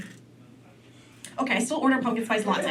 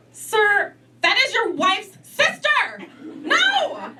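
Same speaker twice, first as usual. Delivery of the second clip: scolding; 11 semitones higher, 3 dB louder, much slower than usual. Acted. Scolding the uncle.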